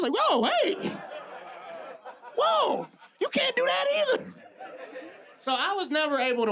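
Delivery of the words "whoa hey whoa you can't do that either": very high-pitched mock alarm; shocked objection. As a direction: cut in with a squealed, comic protest. Acts shocked objection in retold dream.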